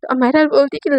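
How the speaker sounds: usual speaking voice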